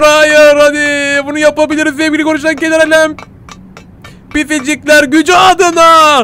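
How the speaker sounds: rally like a cartoon superhero; hammed-up hero cry; mock battle cry.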